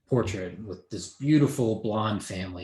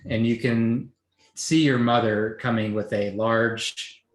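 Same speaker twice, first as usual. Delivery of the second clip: usual speaking voice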